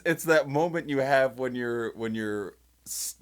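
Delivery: normal talking voice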